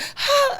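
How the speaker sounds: a sudden high, loud gasp of shock; sharp gasp. Retold or imitated. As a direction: suck the air in as if the room just froze. Whole room reacts in shock.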